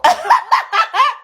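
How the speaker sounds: high burst of laughter; loud incredulous cackle; shocked laugh. Real, not acted. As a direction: laugh out in disbelief.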